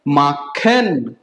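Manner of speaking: माखन low and slow, as "maa-khen"; German machen. Shown as a demonstration. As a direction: split माखन into two drawn-out syllables. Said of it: "क्या बनता है".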